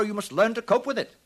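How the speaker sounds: ordinary delivery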